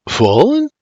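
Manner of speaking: high, rising stunned question; mumbled disbelief. A narrator's reading. William struggles for words before Mary, stunned questioning. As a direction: echo the word, baffled and tentative. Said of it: "he mumbles".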